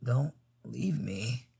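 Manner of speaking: very soft, slow pleading; weak plea. Acted. Fallen rider to Tom, faint plea. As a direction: barely breathe out the plea.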